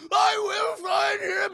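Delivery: high, loud, slow screamed vow; full scream. Retold or imitated. Zod's final vow to Laura, high slow scream. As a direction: stretch out every word at top volume. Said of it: "he's just screaming it".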